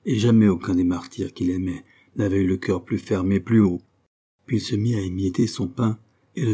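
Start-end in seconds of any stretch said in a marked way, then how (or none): none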